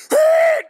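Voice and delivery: a shouted, very high, loud horn "Beep"; blaring horn blast. Shown as a demonstration. Speaker telling teens a car scared him, shouted horn blast. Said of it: "I'm talking".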